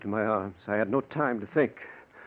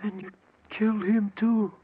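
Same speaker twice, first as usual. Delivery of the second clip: horrified; 11 semitones higher than usual. Acted. Horrified at confession.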